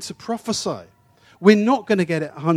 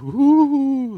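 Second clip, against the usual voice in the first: high, spooky fortune-teller ooh; a hammed-up mystic ooh; 8 semitones higher, 4 dB louder than usual. Mocking fortune telling, high spooky ooh.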